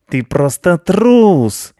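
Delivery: high, loud, very slow yell; an outraged cat hurling an accusation; scornful yell. Acted. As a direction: drag out the insult at full volume. Cat yelling at rat.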